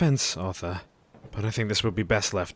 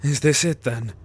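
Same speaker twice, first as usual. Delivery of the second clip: louder, higher anguished questions; despairing cry; 5 semitones higher, 6 dB louder than usual. Acted. Jack despairing to the group, louder and higher.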